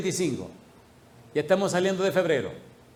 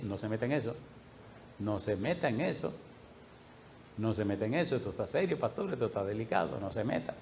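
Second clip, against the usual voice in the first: low, softened retold warning; quiet, repeated caution, acted out; hushed warning; 5 semitones lower, 9 dB quieter than usual. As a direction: warn someone off in a lowered voice.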